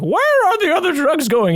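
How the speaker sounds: high strained Batman impression; mock Batman straining to sound tough. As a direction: squeeze up into a cracking Batman voice. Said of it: "when Batman's trying to find his voice".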